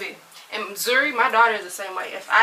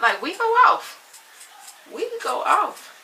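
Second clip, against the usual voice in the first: heated; 10 semitones higher, 5 dB louder than usual. Acted. Keeps the parent rant going.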